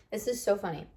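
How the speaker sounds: usual speaking voice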